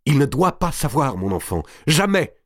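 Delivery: high, urgent exclamation; exclaiming. A narrator's reading. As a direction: cry out to forbid it. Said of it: "s'écria madame de Bouville". (exclamation alarmée)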